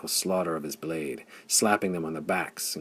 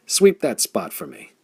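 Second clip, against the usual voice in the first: loud, higher command; a shouted battle order; crying out; 7 semitones higher, 6 dB louder than usual. A narrator's reading. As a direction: call out the order to archers.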